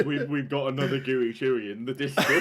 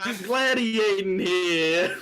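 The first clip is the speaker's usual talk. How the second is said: slow, drawn-out mock American accent; weird American accent; 3 semitones higher, much slower than usual. Co-host as American Spartacus, drawn-out hammy accent. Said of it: "famed for his weird American accent".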